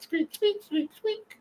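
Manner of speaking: very high squeak squeak; squeaky noise. Pretend. Host joking he is squeaky clean, voicing high squeaks. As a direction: squeak like a clean dish being rubbed. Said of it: "it goes squeak".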